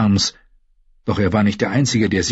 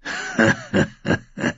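growling laugh; 4 dB quieter than usual. Acted. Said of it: "Sein Lachen klang wie ein Knurren".

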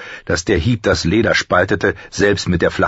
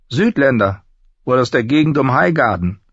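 slightly higher character voice; a hint of a higher-voiced boy recruit; lightly voiced Pip; 7 semitones higher than usual. Pip tells Jon where the newcomer is from, lightly voiced.

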